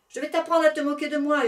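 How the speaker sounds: high, loud furious yell. Acted. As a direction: bellow the threat at the whale. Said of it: "hurla l'éléphant".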